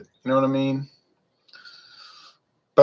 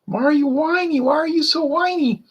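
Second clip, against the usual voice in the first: much higher, put-on whiny whimper. Taster mocking the beer's whiny flavor, in a high whine. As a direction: whine it like a pouting child. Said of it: "it kinda has that little whiny".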